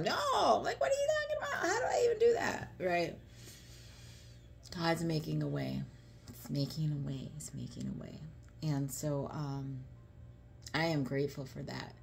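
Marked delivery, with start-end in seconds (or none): 0.0-2.7 high, fast, near-crying cry of protest; overwhelmed pleading shout; distressed protest. Retold or imitated. Retold protest against God. 7.4-8.2 breathy whispered repeat, much softer; hushed awed whisper. Real, not acted.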